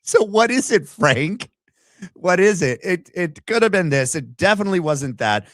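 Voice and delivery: high, loud exasperated taunt at Frank's changing story; mocking exasperation. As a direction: needle Frank with a sing-song taunt. Mocking Frank's flip-flopping story.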